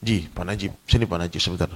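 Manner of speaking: confiding gossiper leaning in close; sly gossip. Retold or imitated. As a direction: lure a friend aside to share gossip. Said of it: "kan setan nih licik".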